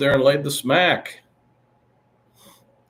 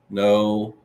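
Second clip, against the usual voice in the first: usual speaking voice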